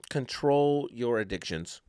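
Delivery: slow, measured pronouncement; stately, spaced-out appeal; presidential address. Acted. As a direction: deliver it slowly like a president's message. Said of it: "talking like a goddamn president".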